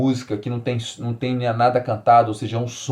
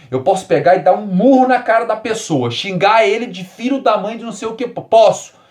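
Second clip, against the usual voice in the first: mock anger; 8 semitones higher, 4 dB louder than usual. Imagines punching someone in anger.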